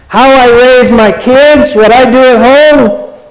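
high indignant churchgoer parody; mocking churchgoer. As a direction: throw their defensive demands back at them.

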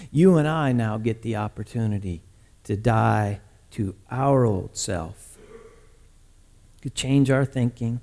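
low, soft, solemn sermon delivery; hushed preaching. Acted. Preacher explaining sin to the congregation, hushed and solemn. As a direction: let each phrase settle with long pauses.

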